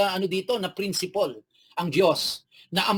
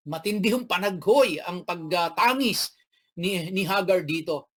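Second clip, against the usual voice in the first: normal talking voice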